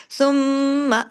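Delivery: सुम्मा with nasal मीम and a clean stop, no extra आ; tajweed demo; ghunna, clean stop. Shown as a demonstration. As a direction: stop the voice right after सुम्मा. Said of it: "आवाज को इधर रोक दें".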